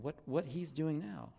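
ordinary talk, nothing marked

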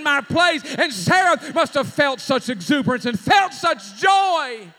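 loud, driving sermon climax with rising fervor; preaching at full force. Acted. Preacher building the story to a shout for the congregation, full force. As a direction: pour it out to the congregation like a revival.

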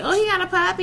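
teasing, put-on excited quote; mocking imitation. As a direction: lightly mimic someone cooing. Mocking the homegirl's reaction to the puppy.

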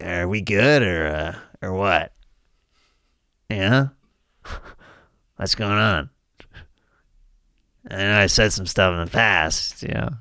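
low, subdued podcast host; end-of-interview check-in, low and halting; Marc Maron. Acted. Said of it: "My impression of Marc Maron".

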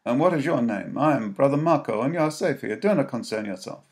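kindly friar, booming reassurance; jolly booming friar. A narrator's reading. Monk voiced in the story.

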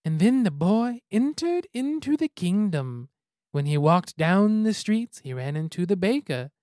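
slow, singsong story narration; weird storytelling voice. Shown as a demonstration. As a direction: read it like a goofy fairy tale. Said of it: "really weird storytelling voice".

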